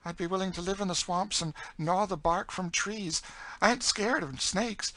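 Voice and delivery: fervent high-voiced eagerness; eager. A narrator's reading. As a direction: insist eagerly, pitched right up. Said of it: "said Emmeline eagerly".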